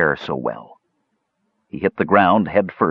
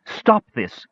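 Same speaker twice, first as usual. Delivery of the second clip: mild yell, higher; 5 semitones higher than usual. A narrator's reading. Brandella tries to stop the fight, mild yell. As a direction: throw yourself between them.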